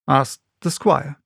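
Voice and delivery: ordinary delivery, nothing marked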